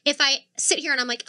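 usual speaking voice

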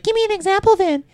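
very high, squeaky mock of his girlfriend; girlfriend's voice, mocked. Retelling an argument, mimics girlfriend in a squeaky voice. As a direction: pitch way up, mimicking her indignant demand. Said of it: "she's like".

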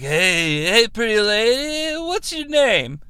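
stretched-out, rising pick-up line. Retold or imitated. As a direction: imitate a sleazy come-on, slowly sliding upward. Acted drunk character's pick-up line.

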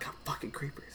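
whispered gamer curse; ASMR gamer. Pretend. Host plays Minecraft as ASMR, whispering curses. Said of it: "I could A S M R".

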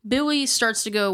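normal talking voice

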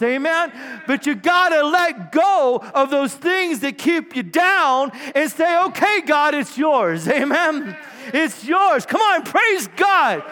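loud, high, rousing preacher's cry; preaching at full force. Acted. Pastor rousing the church to give it all to God, shouting. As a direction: lift the congregation with a shouting call to surrender.

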